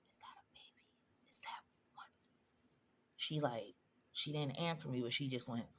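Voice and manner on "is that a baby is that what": hushed unvoiced whisper to the nurse. Patient whispering a secret question to the nurse. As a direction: breathe the question without voice. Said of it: "I just whispered".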